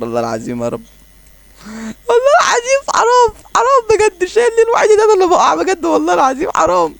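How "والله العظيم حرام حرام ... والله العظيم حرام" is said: high, loud hammed-up fake crying wail; theatrical fake sobbing plea, much higher and louder; mock wailing. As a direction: wail it like a crying child, overdone. Mock wailing over losing.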